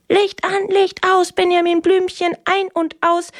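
high, chanted commands from the top bunk; big brother bossing. Retold or imitated. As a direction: call the rhyme down like a bossy big brother. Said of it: "von oben herab aus dem Stockbett dirigiert".

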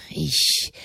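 voiceless, choked-off word; words catching in the throat; a breathless, choked "Ich". A narrator's reading. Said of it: "er griff sich an die Kehle".

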